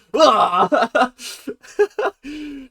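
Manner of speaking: loud amused burst of laughter. Real, not acted. Viewer laughing at her Melissa imitation, loud amused laugh. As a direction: laugh at your own impression.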